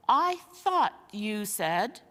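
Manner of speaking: slow, halting words of a confused bear; slow puzzled voice. Acted. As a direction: drag it out, lost in thought. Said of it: "in a slow, puzzled voice".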